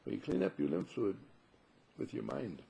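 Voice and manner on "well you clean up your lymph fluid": normal talking voice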